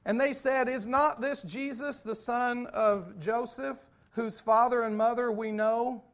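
loud, high pulpit reading of the gospel; preaching scripture. Acted. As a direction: proclaim the verse to the congregation. Reading scripture at full force.

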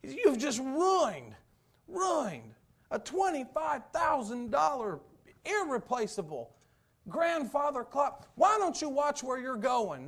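loud, high angry yelling; furious man yelling over his shattered clock; retold yelling. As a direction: holler it at the clumsy stranger. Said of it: "he's yelling and hollering".

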